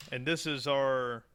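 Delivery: usual speaking voice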